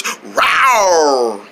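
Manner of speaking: long, low falling rawr; tiger roar. Acted. [name] playing tiger, roaring.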